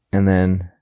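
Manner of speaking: ordinary talk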